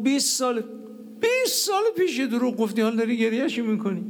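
very high, incredulous retelling; astonished protest. Retold or imitated. Son reacts to father crying over a twenty-year-old lie, astonished protest. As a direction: pitch it right up in mock amazement.

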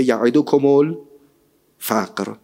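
very slowly stretch each syllable of یعدکم الفقر; drawn-out Quran recitation. Acted. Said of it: "قشنگ یعدکم الفقر". (یعدکم الفقر را خیلی کشیده و آهسته بخوان)

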